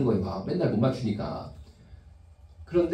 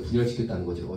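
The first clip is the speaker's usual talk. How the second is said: 분열시켰다는 repeated much lower; lower echo; 7 semitones lower than usual. Shown as a demonstration. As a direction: sink the pitch on 분열시켰다는.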